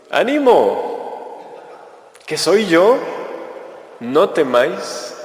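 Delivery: high, slow, drawn-out "Ánimo"; Jesus calling out. Acted. Jesus calling to frightened apostles on the sea, reassuring. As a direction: call out calmly across the water to reassure.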